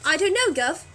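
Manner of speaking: put-on a quick London gov accent; Cockney accent. Friend jokes in a London accent after the British line. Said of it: "you were doing a West End accent".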